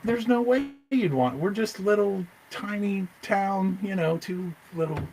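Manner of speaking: small, high-pitched voice; humble disbelief. Retold or imitated. Small-town seller doubting he is wanted, high humble disbelief. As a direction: squeak it out, slowing on the humble part.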